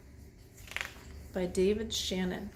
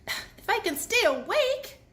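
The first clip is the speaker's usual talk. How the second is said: high, playful little bee voice; jokey high bee voice; joking Lizzie; 11 semitones higher, 3 dB louder than usual. A narrator's reading. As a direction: tease it up high and light. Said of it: "joked Lizzie".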